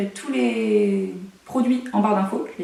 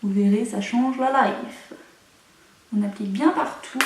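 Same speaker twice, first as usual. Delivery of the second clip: usual speaking voice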